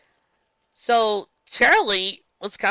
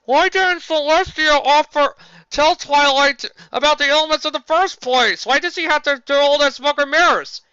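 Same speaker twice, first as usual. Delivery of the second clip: higher, louder whiny questioning; mocking a complainer; 8 semitones higher, 7 dB louder than usual. Reviewer mocking fans who question Celestia, whiny and loud. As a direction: mimic a fan's nagging question, louder and pitched up. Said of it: "whenever somebody suggests".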